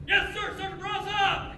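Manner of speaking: high-pitched mock yell, held back in volume; eager airman's holler at reduced volume; retold yell. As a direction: imitate an over-keen airman hollering. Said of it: "He yelled in front of the shop".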